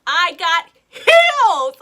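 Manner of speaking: loud, drawn-out comic outburst; exasperated shout. Retold or imitated. Telling friends why she tripped running, exasperated shout. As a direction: shout it slowly for dramatic effect. Dramatic retelling of her fall in heels.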